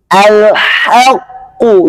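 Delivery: very slow "Al-haqqu" with thin h. Shown as a demonstration. Teacher models the thin reading. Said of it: "Berarti bacanya tipis".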